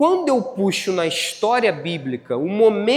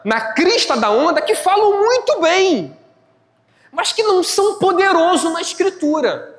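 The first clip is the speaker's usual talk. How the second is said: high, raised preacher's voice; full-force preaching; 9 semitones higher, 4 dB louder than usual. Real, not acted. Preacher warning church about shallow speakers, impassioned. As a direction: drive each point home emphatically, high and forceful.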